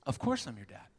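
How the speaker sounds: gentle, heartfelt dad talk; tender reassurance. Retold or imitated. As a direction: reassure your little girl up close. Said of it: "I got down real close to her".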